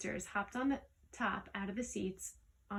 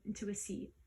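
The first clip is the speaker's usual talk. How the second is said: ordinary delivery, nothing marked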